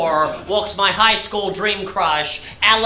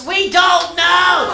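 exasperated yell; 8 semitones higher, much slower than usual. Real, not acted. Yelling answer to customer.

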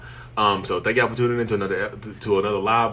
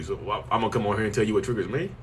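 ordinary talk

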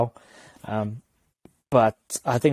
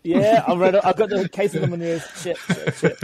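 rattled; 7 semitones higher than usual. Real, not acted. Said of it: "Got 'em rattled, boys".

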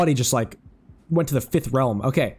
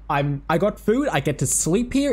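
usual speaking voice

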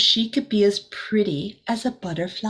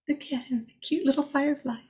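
higher, softer coo over something cute; cooing aside; 5 semitones higher, 5 dB quieter, faster than usual. Real, not acted. Reader to child about the firefly, cooing softly.

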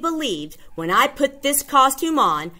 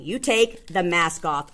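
usual speaking voice

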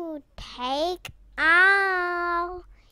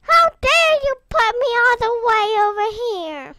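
high, loud scolding; loud, affronted complaint; indignant outburst; 4 semitones higher, 9 dB louder than usual. Real, not acted. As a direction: rail at them for the affront. Protests being moved in game.

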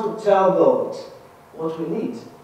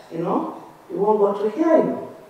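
usual speaking voice

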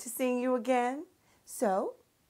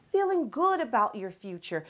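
ordinary delivery

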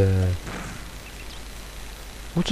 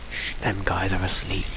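hushed; 2 semitones lower, 11 dB quieter than usual. Real, not acted. Sneaking past sleeping pirates.

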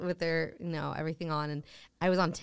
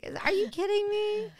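much higher, incredulous squeal; stunned disbelief; 13 semitones higher than usual. Retold or imitated. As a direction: shriek it in mock disbelief. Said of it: "She's like".

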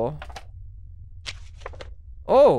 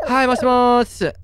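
loud visitor voice; 10 semitones higher, 9 dB louder than usual. Acted. Voicing visitor greeting.

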